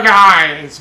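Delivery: ordinary talk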